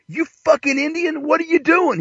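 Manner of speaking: very high, strained barman voice; hammed-up, high indignant barkeep; angry bartender. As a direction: demand an answer in high-pitched mock outrage. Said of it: "And the bartender goes".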